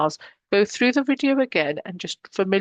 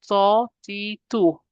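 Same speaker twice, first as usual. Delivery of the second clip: ordinary delivery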